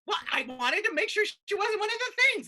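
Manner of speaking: high-pitched, indignant self-defence; acted flustered justification; defensive protest. Defends stabbing after accusation.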